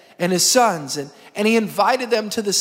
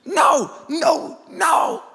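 high-pitched, hammed-up cries of refusal; mock horrified protest; 8 semitones higher, much slower than usual. Brothers protesting that David is fetched, mock horror. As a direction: shoot the pitch way up in comic horror.

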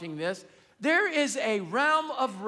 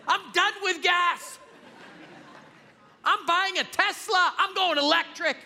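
exasperated outburst; 7 semitones higher than usual. Retold or imitated. Frustrated outburst at the pump.

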